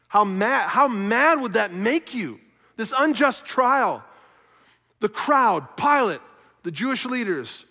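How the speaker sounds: loud, high preaching indignation; impassioned pulpit outrage; outraged. Real, not acted. Preacher voices outrage at unjust trial.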